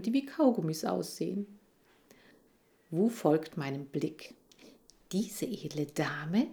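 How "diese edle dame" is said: whisper. Acted. Wu introduces the librarian.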